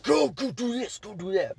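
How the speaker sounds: high, pushy orders; mock bossy manager. Imitating the manager giving orders, high and bossy.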